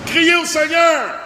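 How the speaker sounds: high, loud and slow shout. Shown as a demonstration. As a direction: cry out to the Lord at full force. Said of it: "Crie en français". (crier au Seigneur, haut, fort et lentement)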